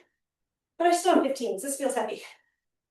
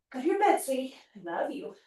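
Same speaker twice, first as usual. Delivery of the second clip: tender, softening to a murmur; coaxing pet talk; 6 dB quieter than usual. Real, not acted. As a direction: sweet-talk the dog off to bed. Coaxing the dog to bed.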